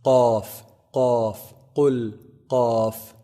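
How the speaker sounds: "Qaf" popped from the deepest part of the tongue; deep Arabic qaf. Shown as a demonstration. Teacher modelling the farthest-back letter, deep "Qaf". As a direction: say "Qaf" far back, close to the throat. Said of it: "from the deepest part of the tongue".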